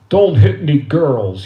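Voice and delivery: loud, slow fatherly order; imitating a father's parting warning. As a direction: lay it down firmly as Dad, slowly. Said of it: "And my father, before he left, he said".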